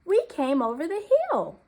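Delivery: high, loud eager shout; excited cry. A narrator's reading. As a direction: call out remembering the way. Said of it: "cried little deer".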